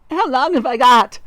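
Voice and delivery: high, loud, panicked wail; wife crying out. A narrator's reading. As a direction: plead loudly at high pitch. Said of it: "cried Missus Twit".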